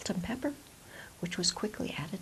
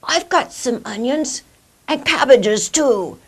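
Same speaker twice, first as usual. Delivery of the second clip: put-on old man voice; 5 semitones higher, 14 dB louder than usual. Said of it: "said an old man".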